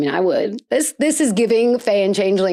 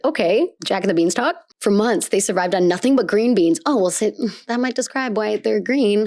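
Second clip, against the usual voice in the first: normal talking voice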